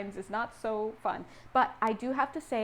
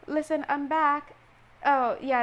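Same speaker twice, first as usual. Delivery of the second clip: loud, raised homecoming call; acted announcement; 4 semitones higher, 7 dB louder than usual. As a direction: call out that you're back. Acts out announcing a return home.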